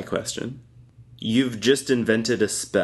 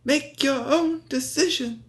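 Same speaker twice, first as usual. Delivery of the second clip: put-on very high, airy old wizard; imitating film Dumbledore, high wizard voice; Dumbledore impression; 14 semitones higher than usual. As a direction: play a wise old wizard lightly. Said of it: "He's like".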